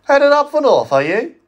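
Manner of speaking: high, loud cheeky little diesel; diesel shunter voice. Acted. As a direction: pipe it up like a small engine. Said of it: "asked a voice".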